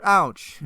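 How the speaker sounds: high, loud yelp of "ouch"; pained ouch. Acted. Script reader voicing a man as the arrow comes out, pained ouch. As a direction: cry out in acted pain.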